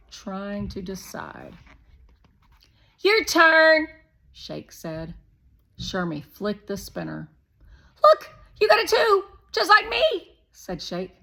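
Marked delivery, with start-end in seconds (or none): 3.0-3.9 put-on high, loud pushy boy; Shake voice. A narrator's reading. Narrator voicing Shake, a loud, high pushy boy. 8.0-10.4 put-on high, loud pushy boy; Shake voice. A narrator's reading. Narrator voicing Shake, a loud, high pushy boy.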